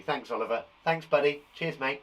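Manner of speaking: ordinary talk, nothing marked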